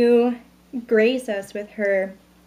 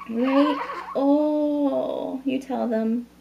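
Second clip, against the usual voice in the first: put-on a touch of higher cooing baby talk; 4 semitones higher, much slower than usual. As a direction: ask the baby to back her up. Mother speaks to baby.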